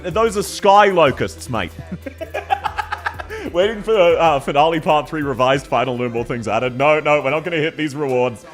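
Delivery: ordinary delivery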